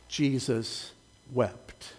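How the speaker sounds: soft, slow, solemn reading of Jesus wept; hushed scripture reading. Shown as a demonstration. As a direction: let each word fall quietly, reverently. Slowly reading the key verse aloud.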